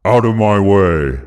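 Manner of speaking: mildly forceful villain demand. A narrator's reading. Villain confronting the hero who blocks him, demanding. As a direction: order him aside in a changed character voice. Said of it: "Alexander demanded".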